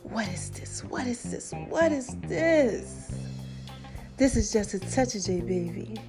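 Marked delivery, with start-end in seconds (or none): none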